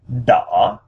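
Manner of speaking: đỏ dipping, then lifting slightly; dipping-rising tone. Shown as a demonstration. Showing learners how to say red, đỏ down then up. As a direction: draw đỏ down and up like asking. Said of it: "lowering our voice and then raising".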